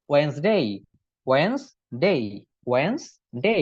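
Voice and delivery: "ওয়েডনেসডে" drilled as wenz-dei, first d silent; correct pronunciation. Shown as a demonstration. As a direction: repeat "ওয়েডনেসডে" as two deliberate syllables, wenz-dei. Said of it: "এরকম হবে".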